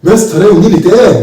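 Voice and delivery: ordinary talk, nothing marked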